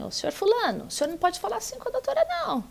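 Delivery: high, fast mock judge telling a man off; a judge's rapid, high scolding of the husband; imitating the judge scolding. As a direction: rattle it off high and quick like a judge reprimanding. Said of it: "ela virou pra ele e disse assim".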